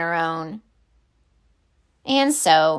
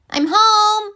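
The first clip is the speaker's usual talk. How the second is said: high sing-song call home; child voice calling; 12 semitones higher, 4 dB louder than usual. Acted. Penelope arriving home calling out, high child voice. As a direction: call out brightly like a kid.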